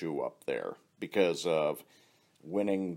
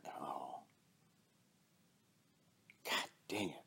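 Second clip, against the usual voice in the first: whisper; 5 semitones lower, 14 dB quieter than usual. Retold or imitated. Friend retells joke, whispered punchline.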